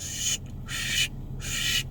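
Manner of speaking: whispered swish of a blade flicking; soft imitated knife clicks; butterfly knife sound. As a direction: hiss like a knife opening and shutting. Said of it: "open and closing that thing".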